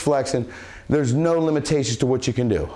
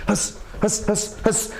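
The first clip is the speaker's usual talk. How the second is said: sharp hissed exhale on each punch; short, unvoiced "huss" bursts; strike breath noise; 7 semitones higher, slower than usual. Shown as a demonstration. Coach showing punches to viewers, sharp breath noise. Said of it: "I make this really cool noise".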